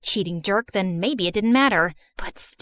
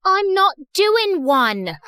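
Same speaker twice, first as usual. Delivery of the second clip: put-on slow, higher, insistent British accent; 7 semitones higher, 3 dB louder, much slower than usual. Kiana arguing with the principal in a British accent. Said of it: "stop with that British accent".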